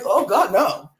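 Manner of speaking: horrified laughter, slow and loud; laughing shocked oh God no. Real, not acted. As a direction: burst out laughing in disbelief. Shocked by sad confession.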